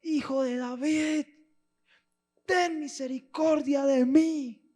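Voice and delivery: high, slow, loud pleading shout; desperate cry. Acted. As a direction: cry out with all your strength. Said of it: "empieza a gritar".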